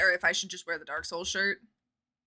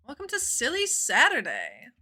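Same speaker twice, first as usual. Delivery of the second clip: ordinary talk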